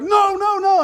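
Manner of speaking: high, loud, urgent bunny protest; frantic no. Retold or imitated. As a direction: stop him before the joke starts. Bunny objects to fox's joke.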